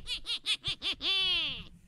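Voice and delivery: high shrieking witch cackle; scary laugh. Pretend. For viewer Rhonda, a shrieking scary cackle. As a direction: cackle like a horror-movie witch. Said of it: "Rhonda deserves a scary laugh".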